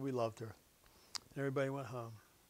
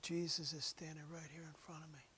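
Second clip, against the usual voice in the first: hushed, breathless whisper of a dying woman; awed whisper; 5 dB quieter than usual. Retold or imitated. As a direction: whisper it as if he stands right there. Dying woman speaks softly.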